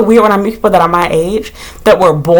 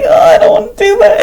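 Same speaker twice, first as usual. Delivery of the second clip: high whiny reluctance; 13 semitones higher than usual. Retold or imitated. Dreading scooping the bug, a high whine. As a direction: whine it like a scared kid. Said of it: "But I'm like".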